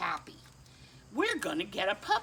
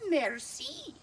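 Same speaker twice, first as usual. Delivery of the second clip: merci with a light French lilt; 7 semitones higher than usual. Acted. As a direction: say merci like a little French lady. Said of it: "That's French for thank you".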